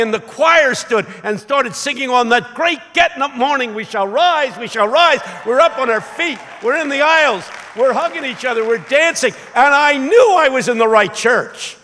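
high, loud, exultant sermon shout; full-force preaching. Real, not acted. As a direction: proclaim victory over death to the whole church.

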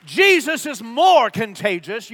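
loud, slow, forceful proclamation; emphatic repeat. Shown as a demonstration. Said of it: "You didn't hear me".

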